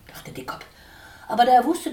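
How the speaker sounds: ordinary delivery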